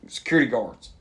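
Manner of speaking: ordinary talk, nothing marked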